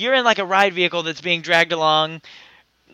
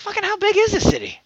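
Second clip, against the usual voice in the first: incredulous. Retold or imitated. Incredulous quote, very high pitch.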